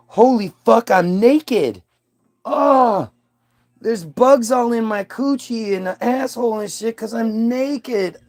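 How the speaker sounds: high, panicked squeal of discovery; shocked Eve. Acted. Eve waking to her nakedness, high panicked voice. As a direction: shriek like she just saw herself.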